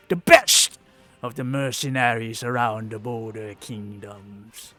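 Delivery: proud mercenary captain, puffed up; boastful hobgoblin captain. Acted. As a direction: brag about the Red Hand.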